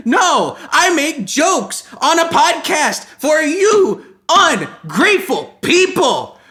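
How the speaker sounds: high, loud exasperated rant; mock-furious, punching out words at high pitch; hammed-up outrage. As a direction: yell it in comic indignation. Comic outburst at ungrateful audience.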